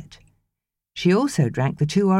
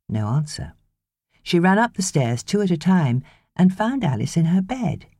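plain reading voice

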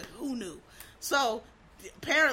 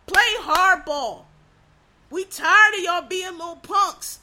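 louder, drawn-out shout; slow loud demand; 3 semitones higher, 8 dB louder, slower than usual. Real, not acted. Comic ranting at politicians, a slow loud shout. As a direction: hammer each word home, slowly.

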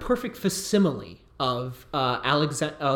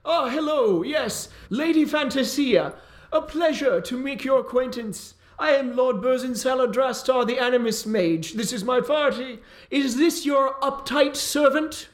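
a much higher, grandly boastful lord; pompous mage; 11 semitones higher, 3 dB louder than usual. Acted. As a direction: boom out like a vain wizard. Plays Burzin the mage.

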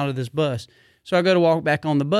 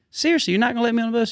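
incredulous; 7 semitones higher, much faster than usual. Retold or imitated. Arguing with security guard.

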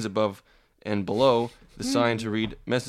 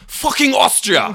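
shouted, higher and louder; mock aggressive shout; 11 semitones higher, 7 dB louder than usual. Playing a grumpy local answering a tourist, shouted. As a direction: bark the town's name like an angry local. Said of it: "don't be so aggressive".

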